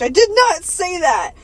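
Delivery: indignant protest. Acted. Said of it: "I interject".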